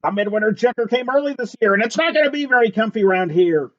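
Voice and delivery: shouted warning over the rain; yelling. Acted. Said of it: "he yelled".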